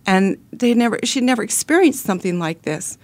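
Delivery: usual speaking voice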